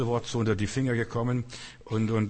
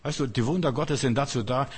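normal talking voice

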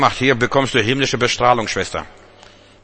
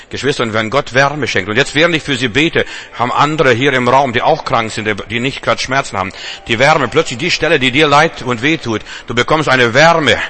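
rising preaching; 3 semitones higher, 3 dB louder than usual. Acted. Preaching to the congregation.

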